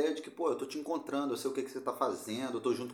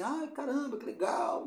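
high, breathy gushing fan; excited fan reaction; 10 semitones higher than usual. Retold or imitated. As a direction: gush like a delighted follower. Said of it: "elas meio que reagem, assim".

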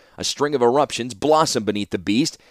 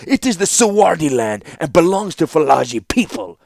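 put-on higher, louder, angry accented ruler; snapped; 7 semitones higher, 5 dB louder than usual. A narrator's reading. Young ruler disputing the warlord's claim to the land, snapping. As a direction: snap back with the rightful claim. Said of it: "snapped the Kadir".

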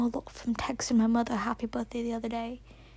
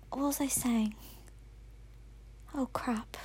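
much softer whispered self-talk; 12 dB quieter than usual. Real, not acted. Forgetting what she was saying, whispered to herself. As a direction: mutter to yourself after losing the thread. Losing her train of thought.

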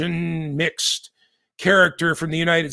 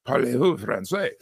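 mock French accent; 5 semitones lower, 4 dB quieter than usual. Mock French after restaurant joke.

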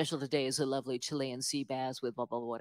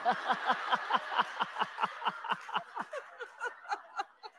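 high laughter; 3 dB quieter than usual. Real, not acted. Laughing at own story.

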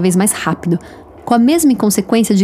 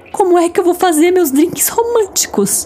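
melodramatic; 9 semitones higher than usual. Acted. Melodramatic lament.

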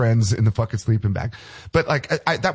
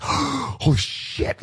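slow, breathless whispered awe; awestruck hushed whisper; 3 semitones higher, 3 dB quieter, much slower than usual. Retold or imitated. Drummer hearing [name] is playing his club, whispered awe. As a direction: hush it out like you can't believe your luck.

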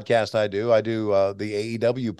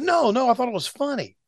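lightly voiced boss, higher and airy; boss quoted; 13 semitones higher than usual. He reassures him in the boss's voice, breezy and higher. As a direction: play the boss brushing it off, amused.

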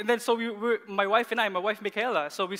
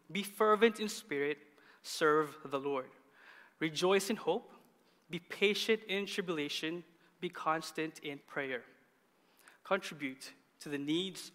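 scripture reading; 3 semitones lower, 4 dB quieter than usual. A narrator's reading. Pastor reading Romans to the congregation, measured scripture reading. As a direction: read the verses out deliberately with pauses.

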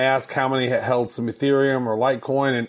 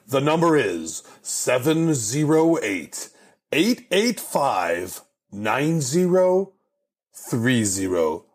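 number read out slowly, digit by digit; slower than usual. Shown as a demonstration. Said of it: "The secret number".

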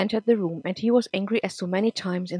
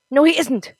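high, loud flat refusal; loud denial; 7 semitones higher, 10 dB louder than usual. Acted. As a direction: shout the no back at her. Nick denies, shouting.

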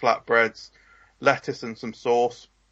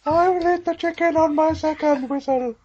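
much higher, put-on referee voice; mock referee; 3 dB louder than usual. Joining the Gladiators skit as a high comic referee. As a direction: call it like a silly Gladiators referee.

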